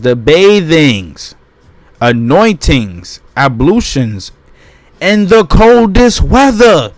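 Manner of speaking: loud, slow declamation of a text; emphatic reading. Acted. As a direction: proclaim each phrase, punching the key words. Reads passage aloud emphatically.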